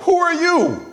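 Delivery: high, drawn-out challenge; lightly voiced prophet demanding who are you; prophet's challenge. Preacher voicing the prophet who rebuked Job, challenging. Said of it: "act like the prophet that told Job".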